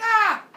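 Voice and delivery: piercing high loud shriek; scream. Acted. Girl struck down by the teacher, high loud scream. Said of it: "she's like".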